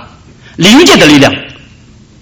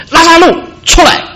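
much higher, ringing loud summons; loud call; 13 semitones higher than usual. Acted. Jesus crying out to Lazarus in the tomb, loud commanding call. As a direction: cry out the name as a command. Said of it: "大声的呼叫说".

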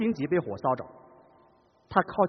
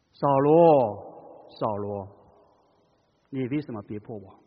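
loving, grieving; 7 semitones lower, much slower than usual. Shown as a demonstration. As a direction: plead gently like a grieving father. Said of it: "充满着怜悯，充满着爱，充满着痛惜".

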